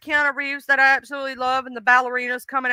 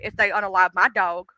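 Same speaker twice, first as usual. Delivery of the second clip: ordinary talk, nothing marked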